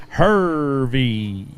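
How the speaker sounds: Hervey said very slowly, low and drawn out; slow careful name. Shown as a demonstration. Announcer mimics the angler's slow Hervey, low and drawn out. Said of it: "Do I gotta say it slow like that".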